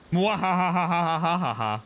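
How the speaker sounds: evil laugh; mad scientist cackle. Acted. Evil laugh at the villain moment.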